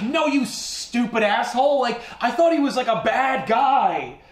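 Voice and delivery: high-pitched mock indignation; acted outrage. Woman backhanding the bartender, acted outrage. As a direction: stretch the insult out, high and indignant.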